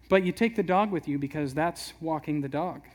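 ordinary talk, nothing marked

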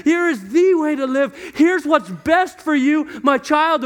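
swelling, insistent preacher voicing God; God's impassioned love. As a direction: plead your love as a devoted father. God pleading love, acted out.

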